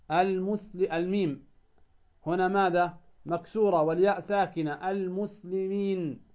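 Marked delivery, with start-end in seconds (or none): 4.9-6.2 المسلمين with the ياء held long and slow; stretched madd. Shown as a demonstration. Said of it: "فالحرف الذي يسبق الياء".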